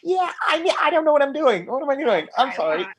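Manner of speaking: high, nervous, rambling apology; flustered fanboy. Retold or imitated. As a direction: babble nervously at your hero. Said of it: "And I was like".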